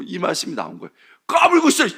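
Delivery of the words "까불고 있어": much higher, loud mock yell; mock shout. Council yelling at Nicodemus, mock shout. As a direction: yell it down at him.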